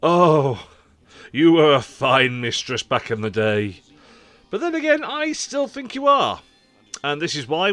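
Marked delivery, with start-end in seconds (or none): 0.0-3.8 loud, slow, grand poetic recital; mock poetic ode. Mock love poem to console. 4.5-6.4 much higher, playful little voice; coy high aside. Pretend. Coy aside after ode.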